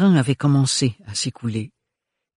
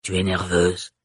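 low, pleased guttural voice; satisfied old woman; 5 semitones lower than usual. A narrator's reading. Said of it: "avec satisfaction".

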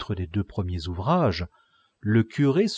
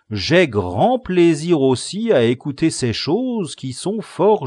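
put-on a touch louder, dreamy servant girl; lightly voiced maid; 7 semitones higher, 9 dB louder, slower than usual. A narrator's reading. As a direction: savour the romance, lightly voiced. Maritorne speaks in the tale.

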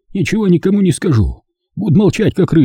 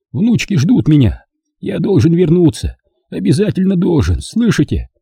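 higher pleading voice close to sobbing; breaking into tears; 8 semitones higher than usual. A narrator's reading. Old man begs to return to his granddaughters, tearful plea. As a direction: beg for the granddaughters, near crying. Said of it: "Срывался он на плач".

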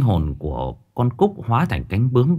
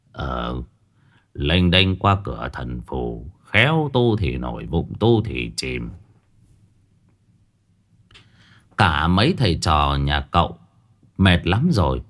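put-on cheerful old man; 6 semitones lower than usual. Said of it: "có vẻ vui vẻ lắm".

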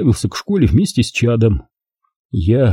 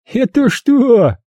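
smiling mockery; 12 semitones higher, much slower than usual. A narrator's reading. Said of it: "с улыбкой".

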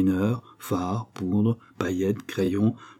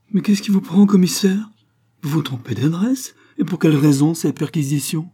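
indignant protest; 8 semitones higher, 6 dB louder than usual. Acted. Valentin protests police raid.

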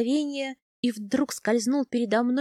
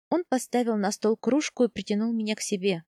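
plain reading voice

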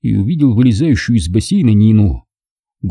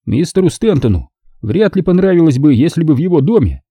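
lightly higher, formal butler voice; polite butler; 7 semitones higher than usual. A narrator's reading. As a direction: speak as the discreet, proper butler. Butler's held voice.

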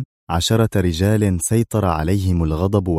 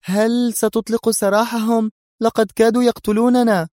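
Mona, indignant; 14 semitones higher than usual. A narrator's reading. Mona protests to the lieutenant letting them go, indignant. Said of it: "هتفت به منى في استنكار".

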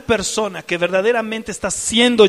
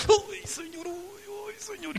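overwhelmed moan; 11 semitones higher, 12 dB quieter than usual. Retold or imitated.

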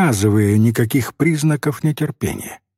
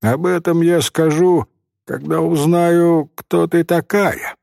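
put-on patient, higher-pitched boy's reply; higher, unhurried child's answer; 6 semitones higher than usual. A narrator's reading. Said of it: "терпеливо отвечал мальчик".

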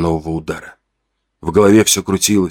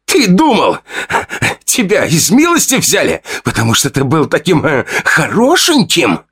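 much higher, rasping yell of spite; hoarse outburst; 7 semitones higher than usual. Acted. Fedya, choking on rage, yells what he held back for years. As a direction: finally spit out the hidden grudge. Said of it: "он выкрикнул".

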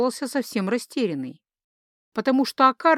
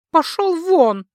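high, loud angry burst; angry dismissal; 8 semitones higher, 7 dB louder, slower than usual. Acted. Goddess ordering her rival off her ground, angry. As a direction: throw them out, loud and high. Goddess quarrels with dark god.

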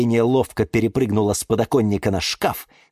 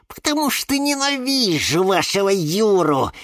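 raised pitch, jealous venom in a flowing phrase; hateful outburst; 7 semitones higher than usual. Acted. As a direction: spit out your jealousy in one run. Jealous plant declares her hatred.

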